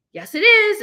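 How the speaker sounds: high, loud argumentative retort; loud contradiction. Acted. Boy contradicts his friend in a childish quarrel, loudly. Acted back-and-forth quarrel.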